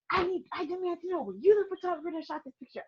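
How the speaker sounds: high, imitated angry demand; upset husband. Angry husband at studio door, imitated high demand. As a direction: mimic a furious husband demanding answers. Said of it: "Oh God, he was upset".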